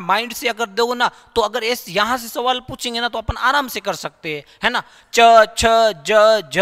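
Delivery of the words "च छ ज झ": च छ ज झ said loud, tongue behind the teeth; teacher demonstrating palatal consonants; palatal sounds demo. Shown as a demonstration. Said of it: "जब भी बोलते हैं तो जिह्वा बार बार".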